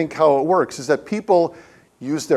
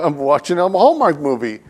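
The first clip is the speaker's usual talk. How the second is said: normal talking voice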